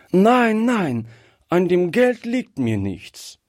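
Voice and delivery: put-on upset little girl's protest; child crying out. A narrator's reading. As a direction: refuse hotly in a high child's voice. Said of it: "rief Gretchen".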